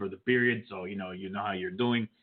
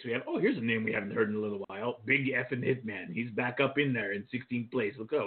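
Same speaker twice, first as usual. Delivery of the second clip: ordinary talk, nothing marked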